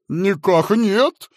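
high, loud, slow servile report; frightened fat man. Acted. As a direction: snap to attention in fear.

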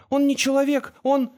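high-pitched, frantic warning; urgent exclamation. A narrator's reading. Girl warns friend against the creature, frantic exclamation. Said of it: "воскликнула Тамара".

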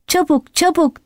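high-pitched shout at ordinary volume; urgent cry. A narrator's reading. Benjamin hurrying the animals, urgent high cry. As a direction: call out urgently, pitched well up. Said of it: "diye bağırıyordu Benjamin".

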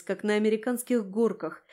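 usual speaking voice

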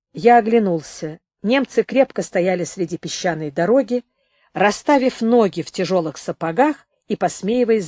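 ordinary delivery

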